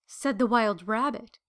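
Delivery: ordinary delivery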